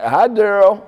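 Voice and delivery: high, loud imitated hello; perky phone hello, acted out; mock cheery greeting. Staff greet him on the call.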